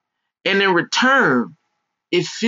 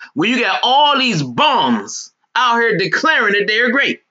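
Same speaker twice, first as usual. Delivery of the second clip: normal talking voice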